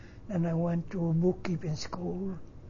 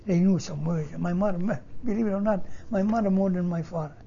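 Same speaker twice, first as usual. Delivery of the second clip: usual speaking voice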